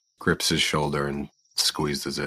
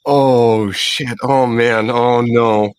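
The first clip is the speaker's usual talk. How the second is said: loud, high, shocked swearing; alarmed outburst; 6 semitones higher, 10 dB louder, slower than usual. Real, not acted. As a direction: blurt it in sudden alarm. Player sees hidden gun.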